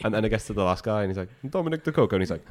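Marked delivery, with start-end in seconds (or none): 1.5-2.2 high-pitched imitation Italian accent; lilting, higher mock-Italian delivery. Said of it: "in Italian accents".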